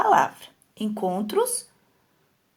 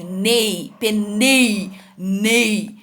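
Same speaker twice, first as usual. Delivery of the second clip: "nei" stretched and pushed in nei, penei, nei; stress on NEI; 2 semitones lower, much slower than usual. Shown as a demonstration. Teacher pointing out the tonic syllable, emphatic stress. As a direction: repeat the syllable nei with firm, held emphasis. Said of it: "Essa é a sílaba tônica".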